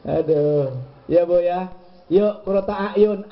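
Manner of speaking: usual speaking voice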